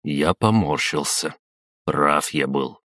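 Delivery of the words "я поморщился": plain reading voice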